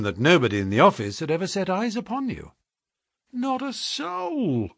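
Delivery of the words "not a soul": high, soft, drawn-out groan. A narrator's reading. Hall Pycroft answers the detective, groaning. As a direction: groan it out slowly and softly. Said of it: "groaned Hall Pycroft".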